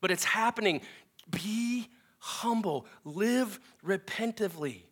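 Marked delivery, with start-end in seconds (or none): 1.3-4.8 slow, breathy whisper of an urgent plea. Acted. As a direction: drop to a whisper and stretch it out slowly.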